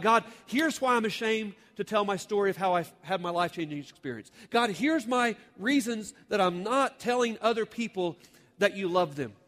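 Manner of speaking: loud, high impassioned preacher; preaching at full force. Acted. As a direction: drive it home to the congregation.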